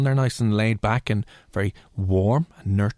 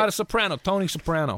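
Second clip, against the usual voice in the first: put-on New York accent; 7 semitones higher than usual. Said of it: "Can you do a New York accent".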